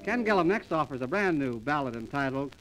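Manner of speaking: ordinary talk, nothing marked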